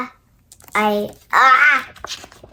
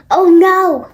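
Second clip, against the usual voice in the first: sudden alarmed gasp; 4 semitones higher, 7 dB louder than usual. Acted. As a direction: gasp then cry out in worry. Gerald panics about sneezing.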